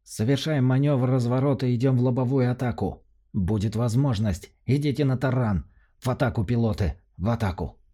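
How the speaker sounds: high, urgent commander's orders; rally the pilots to charge; commander's battle cry. A narrator's reading. Commander gives last battle orders.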